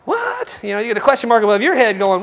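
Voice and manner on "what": mock shock. Stunned at refusal.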